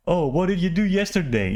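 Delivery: mock small talk. Contrasting shallow conversations with meaningful ones, mock small talk. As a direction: imitate empty polite chit-chat.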